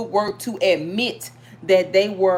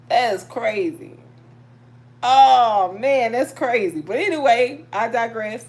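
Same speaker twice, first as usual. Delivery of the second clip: ordinary talk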